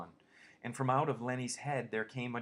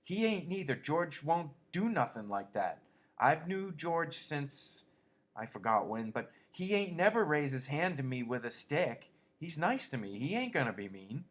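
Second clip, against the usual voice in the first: belligerent; 6 semitones higher, 3 dB louder than usual. A narrator's reading. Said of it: "Now Lenny retorted belligerently".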